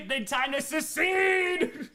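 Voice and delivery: slow, laughing mock shout; mock rallying cry. Streamer joking to Rhea that it's time, hammed-up shout. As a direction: call it out like a hammed-up rebel.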